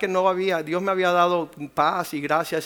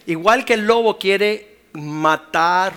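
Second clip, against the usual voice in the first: preaching shout; 3 semitones higher, 10 dB louder, slower than usual. Real, not acted. Preacher thundering about the wolf.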